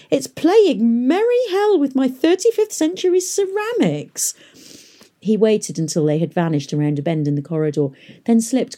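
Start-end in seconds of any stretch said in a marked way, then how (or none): 0.1-4.3 put-on high, thin whining old scholar; reedy old man whine. A narrator's reading. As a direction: whine it like a fussy old doctor. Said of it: "the reedy voice of old Doctor Arkengarth whine".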